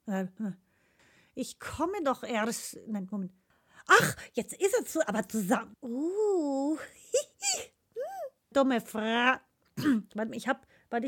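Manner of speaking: usual speaking voice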